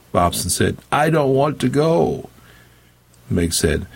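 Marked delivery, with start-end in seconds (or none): 0.9-2.3 high, slow, drawn-out plea; wailing. A narrator's reading. Dying preacher refuses to leave, wailing. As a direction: wail it like a dying man resisting.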